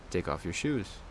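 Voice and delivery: plain reading voice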